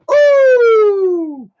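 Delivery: a sudden high, loud ooh. Real, not acted. Streamer yelps at his own gravesite joke, a high loud ooh.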